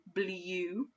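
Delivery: "Blue" said as bliju, quietly; wrong pronunciation. Shown as a demonstration. Teacher showing the class why "Blue" sounds wrong. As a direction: mutter the wrong version "Blue". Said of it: "Doesn't sound right to me".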